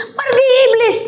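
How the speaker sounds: high, laughing mock rebuke. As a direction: toss it off with a laugh. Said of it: "Saya juga seperti itu".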